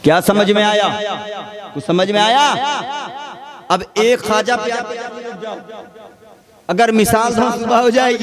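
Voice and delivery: high, loud impassioned sermon climax; fiery preaching. Acted. Preacher revealing the story's hero to the crowd, shouted. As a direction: thunder the revelation to the hall.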